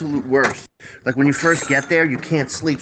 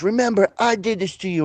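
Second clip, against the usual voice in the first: higher-pitched movie quote; imitated gangster threat; 6 semitones higher than usual. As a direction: quote the mobster's parting threat. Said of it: "and he goes".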